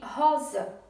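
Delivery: rosa drawn out, RO strongest; slow rosa, leaning on RO; stress on RO. Shown as a demonstration. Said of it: "sílaba forte é ro". (rosa devagar, com RO tônico)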